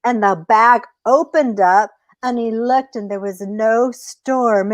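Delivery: ordinary delivery, nothing marked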